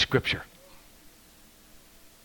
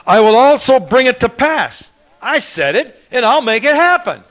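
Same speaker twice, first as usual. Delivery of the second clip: high, loud climactic cry; fervent preacher at full cry; preaching shout; 12 semitones higher, 12 dB louder than usual. Acted. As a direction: shout the promise to the rafters.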